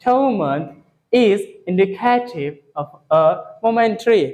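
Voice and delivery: last two month is indicative read very slowly, word by word; slow demo. Shown as a demonstration. Teacher repeats the line slowly for students. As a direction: spell the sentence out slowly for learners. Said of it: "फिर से एक बार देखो".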